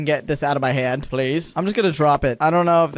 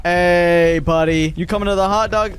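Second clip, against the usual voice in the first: loud, drawn-out hey to a guest; calling out; 4 dB louder than usual. Real, not acted. Park owner hails a troublesome guest, loud and drawn out. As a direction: stretch the greeting out loudly.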